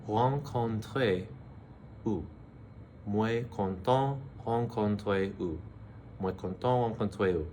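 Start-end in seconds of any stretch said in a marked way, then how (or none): 0.1-2.3 slow, broken-up "Moi content rencontrer vous"; teacher drilling the hardest phrase word by word; slow syllable drill. Shown as a demonstration. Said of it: "Moi, I, um, or me". 3.1-5.6 slow, careful "Moi content rencontrer vous"; teacher modelling the phrase slowly; slow full take. Shown as a demonstration. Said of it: "probably the hardest to say".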